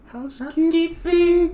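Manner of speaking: high, slow squeaky housekeeper; mock maid voice. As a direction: pipe it up like a timid maid. Quoting movie maid voice.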